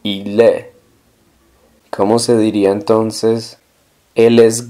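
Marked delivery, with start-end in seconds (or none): 0.0-0.7 il est said "eel-EH"; silent S and T. Shown as a demonstration. Teacher models "he is", il est with silent S and T. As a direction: end il est on the open vowel, no S or T. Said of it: "la S y la T no suenan".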